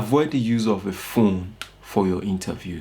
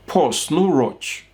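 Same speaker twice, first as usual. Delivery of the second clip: slow, drawn-out, higher; slow demo; 5 semitones higher, much slower than usual. Shown as a demonstration. Coach to job seekers, slow and drawn out. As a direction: spell it out slowly, calm.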